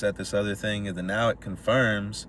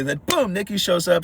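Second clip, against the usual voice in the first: ordinary delivery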